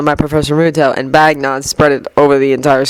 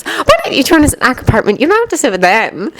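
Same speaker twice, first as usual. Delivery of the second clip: condescending; 7 semitones higher than usual. A narrator's reading. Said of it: "she sounded condescending".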